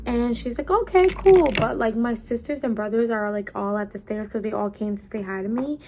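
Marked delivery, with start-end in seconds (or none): 0.7-1.5 high, loud breezy "okay cool"; tipsy mom. Retold or imitated. Tipsy mom brushing off the kids, high and loud.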